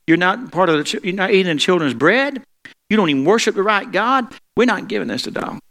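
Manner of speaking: high, scornful dismissal; lightly voiced disdainful refusal; haughty rejection. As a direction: sneer down at the outsider. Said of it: "it's this terrible comment toward me".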